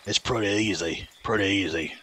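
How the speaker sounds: low, badly faked Australian accent; mock Australian accent. Streamer boasting to chat, mock Australian accent. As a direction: toss it off in hammed-up Aussie. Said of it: "I definitely know how to talk an Australian accent".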